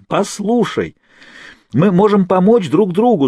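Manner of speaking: high, loud urgent plea; shouted. A narrator's reading. Kate shouting to Naomi that they can help each other. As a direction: shout your offer through the wall. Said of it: "закричала Кейт МакТирнан".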